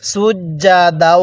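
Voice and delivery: সুজ্জাদাউ with a hard doubled জ, slow; firm জ. Shown as a demonstration. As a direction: press the জ hard and hold it. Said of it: "জিমটা আমরা শক্ত করে উচ্চারণ করব".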